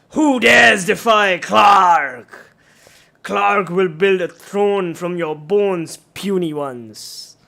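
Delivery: loud, slow booming monster voice; bugbear roar. Acted. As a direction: roar it like a giant brute. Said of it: "the bugbear says".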